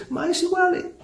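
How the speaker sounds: the devil taunts the church, mock-Italian and high; high, scoffing devil with an Italian "Ma". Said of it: "se ríe y dice".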